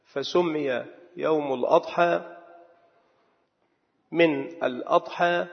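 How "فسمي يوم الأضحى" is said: ordinary talk, nothing marked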